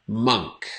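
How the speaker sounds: monk said slowly with a clear uh vowel; correct pronunciation. Shown as a demonstration. Teacher modelling monk for students. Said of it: "But it is".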